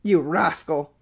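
restrained angry roar; mildly acted furious outburst. A narrator's reading. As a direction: snap at him in understated outrage. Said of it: "roared the professor".

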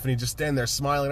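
ordinary talk, nothing marked

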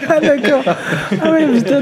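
high laughing exclamations. Real, not acted. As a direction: laugh while reacting. Laughing reaction to the reveal.

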